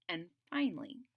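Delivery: usual speaking voice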